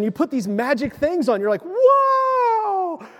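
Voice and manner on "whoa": high startled whoa; mock surprised whoa. Preacher acting out a startled old man, high whoa. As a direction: play the shock of realizing you're old. Discovering you need reading glasses.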